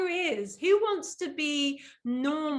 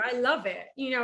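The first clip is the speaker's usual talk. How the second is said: ordinary talk, nothing marked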